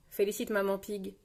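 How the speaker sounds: ordinary delivery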